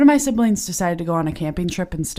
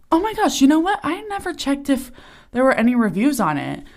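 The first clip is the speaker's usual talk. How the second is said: sudden excitement; 6 semitones higher than usual. Real, not acted. Suddenly remembers to check reviews.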